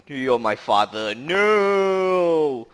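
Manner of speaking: quoted Star Wars line with a cried-out no; movie villain quote. Retold or imitated. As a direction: deliver the famous line, then cry out the no. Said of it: "the infamous scene from Star Wars Episode Five".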